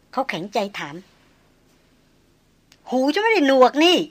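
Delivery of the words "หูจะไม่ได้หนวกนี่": tossed-off, snappish reply, a bit higher; young noblewoman snapping at the hunter; snapped retort. Acted. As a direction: flick the words away with a proud snap. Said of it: "ราชสกุลสาวสะบัดเสียง".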